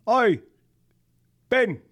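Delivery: high, loud British call of Oi, Ben; a British actor calling out to a friend in a shop; British accent call. Retold or imitated. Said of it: "And he did the accent".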